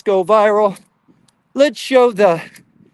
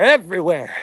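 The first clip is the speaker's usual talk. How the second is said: ordinary talk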